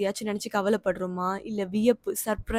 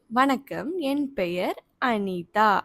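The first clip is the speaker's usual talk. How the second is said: slow dictation; much slower than usual. Shown as a demonstration. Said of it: "இங்க என் பேரை போட போறேன்".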